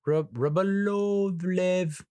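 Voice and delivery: Rybolovlev high and stretched, after a false start; struggling pronunciation. Real, not acted. Reviewer tackling the oligarch's surname, high drawn-out Rybolovlev.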